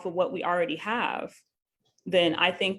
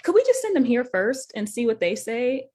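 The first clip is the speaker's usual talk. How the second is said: mock a hedging insurer, stretching the first words; reluctant company voice, ending on a raised question; insurance company rep; 4 semitones higher, slower than usual. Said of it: "your insurance company is like".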